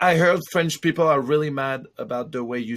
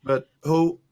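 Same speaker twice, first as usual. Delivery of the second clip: normal talking voice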